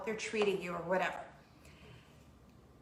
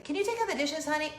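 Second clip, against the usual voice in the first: higher, gently asking mom; sweet mom request; 5 semitones higher, faster than usual. Retold or imitated. Mom asking her teen to do dishes. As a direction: ask sweetly like a patient mom. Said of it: "I say".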